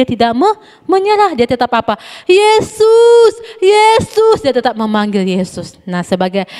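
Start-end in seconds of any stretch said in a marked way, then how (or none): 2.3-4.4 high, loud drawn-out call; yelling Jesus's name. Retold or imitated. Bartimaeus keeps calling Jesus despite the scolding, high loud yell. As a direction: keep crying out his name. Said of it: "dia harus teriak-teriak".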